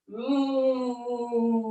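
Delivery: lonely cow moo. Pretend. Teacher voicing the lonely cow for kids, soft moo. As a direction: moo like the last sad cow. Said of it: "we're gonna do a big, loud moo".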